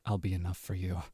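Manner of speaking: clingy, obsessive lover voice; guilt-trip them like a jealous partner; possessive yandere. Acted. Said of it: "P O V".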